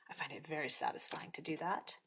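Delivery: satisfied soft whisper. Real, not acted. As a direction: whisper it, pleased. Contented aside.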